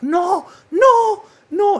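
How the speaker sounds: high, loud wailed "no", drawn out; imitated scream. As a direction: mimic a woman screaming as she's dragged out. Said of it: "she's, like, screaming".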